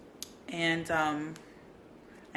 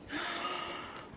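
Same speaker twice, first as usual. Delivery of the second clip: soft high gasp; delighted gasp; 10 semitones higher, 8 dB quieter than usual. Real, not acted.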